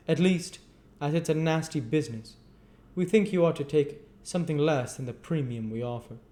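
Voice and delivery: high, loud official bargaining; haggling chairman. Acted. Limbkins haggles with Gamfield, high and loud. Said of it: "replied Mister Limbkins".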